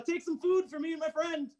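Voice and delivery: high-pitched call after her; mild acted yell. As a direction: call out as she walks away. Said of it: "run and yell that".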